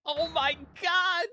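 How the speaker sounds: high drawn-out Oh my god; shocked shriek. Real, not acted. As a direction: squeal in disbelief. Shock at muted friend.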